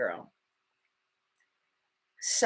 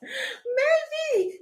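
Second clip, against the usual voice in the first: teasing laugh. Real, not acted.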